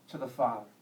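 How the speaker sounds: ordinary delivery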